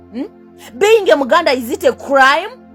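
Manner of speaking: usual speaking voice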